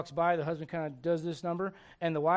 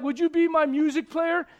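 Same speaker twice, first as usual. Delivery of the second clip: loud, high sweet ask; lightly voiced king; mock king's offer; 10 semitones higher, 10 dB louder than usual. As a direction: coax him like a friendly boss. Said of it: "and Saul was like".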